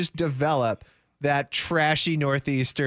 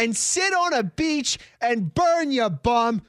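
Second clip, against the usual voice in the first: mock Boston fan; 7 semitones higher than usual. Mocking fan voice on Brady.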